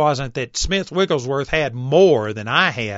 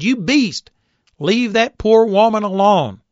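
angry protest; 6 semitones higher, 5 dB louder than usual. Retold or imitated. Audience man protests angrily.

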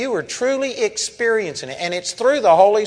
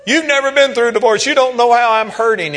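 higher, louder, quicker whiny protest; mock offended; 4 semitones higher, 5 dB louder than usual. Preacher mimics an offended listener objecting, higher, louder and quick. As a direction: play the hurt listener taking offence.

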